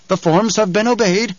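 high, lilting tenor; high musical adviser; sly henchman. A narrator's reading. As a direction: state it with sweet smugness. Piter answers in tenor voice.

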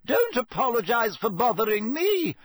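high, indignant sarcastic call; offended, high-pitched complaint; irritable calling. A narrator's reading. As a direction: call after them in a high huff. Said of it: "called irritably after them".

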